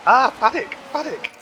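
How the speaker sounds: high, startled shout of a name; surprised cry. Real, not acted. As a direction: cry out in shock. Reacts to driver's crash.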